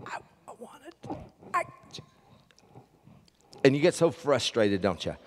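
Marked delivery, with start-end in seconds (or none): 0.1-1.6 strained croaky whisper, voice failing; laryngitis voice. Retold or imitated. Pastor to congregation, imitating a lost voice in a strained whisper. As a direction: push the words out with no voice left. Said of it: "and you go".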